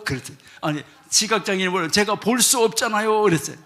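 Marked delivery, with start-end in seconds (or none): 1.9-3.2 louder, indignant denial; loud protest. Retold or imitated. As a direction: protest loudly, wide-eyed innocent. Blind man protests innocence. (주인에게 큰 소리로 억울한 척 항변)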